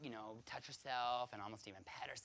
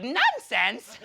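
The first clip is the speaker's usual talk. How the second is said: much louder, high laughing disbelief; a laughing, baffled echo of the title; high, loud, laughing "Nunsense?"; incredulous; 9 semitones higher, 18 dB louder than usual. Retold or imitated. Incredulous reply about the show.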